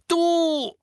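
high, loud, explosive cry; outraged shout; furious outburst. A narrator's reading. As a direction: yell it as the start of a curse. Harry about to curse the elf.

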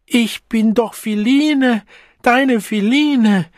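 cling with desperate pleading. A narrator's reading. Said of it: "Verzweifelt klammerte sie sich".

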